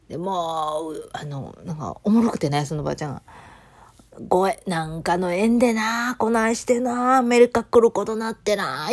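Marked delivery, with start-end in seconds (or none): 4.3-8.9 slow, louder Wakayama-Kansai granny voice; a light take on an old Wakayama lady's Kansai speech. Retold or imitated. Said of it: "すごい関西弁で".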